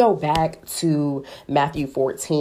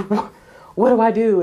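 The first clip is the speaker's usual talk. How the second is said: higher, louder, stammered plea; mock flustered plea; 6 semitones higher, 6 dB louder than usual. Believer turning back to God in a panic, flustered. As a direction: stumble out a helpless question.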